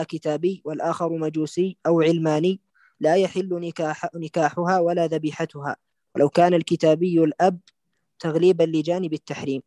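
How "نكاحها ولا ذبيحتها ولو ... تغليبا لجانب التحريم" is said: usual speaking voice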